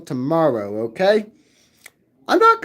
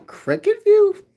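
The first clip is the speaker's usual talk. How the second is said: puzzled repeat; 12 semitones higher, slower than usual. Real, not acted. Puzzled at viewer's comment.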